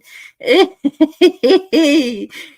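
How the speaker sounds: big helpless laugh; loud laughing fit. Real, not acted. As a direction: burst out laughing loudly.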